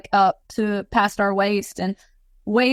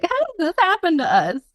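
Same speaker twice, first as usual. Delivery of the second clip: exasperated disbelief; 10 semitones higher than usual. Retold or imitated. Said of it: "Dad's like".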